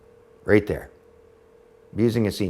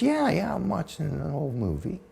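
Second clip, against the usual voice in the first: put-on giddy old mother; 7 semitones higher, 4 dB quieter than usual. Mother giddy after brownie.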